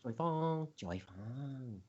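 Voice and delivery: soft, fading mock Chinese accent. Host showing endless repeating in the car, mock Chinese accent. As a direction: trail away chanting in a mock Chinese accent. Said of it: "you have to say it in an".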